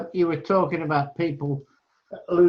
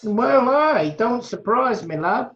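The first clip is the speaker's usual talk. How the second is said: mock West Country accent; 7 semitones higher than usual. Mock local dialect reply.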